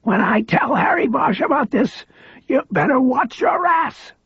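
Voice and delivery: high, strained threat from a weak man; frail high voice, threatening; frail man's threat. A narrator's reading. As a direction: threaten with everything he has left.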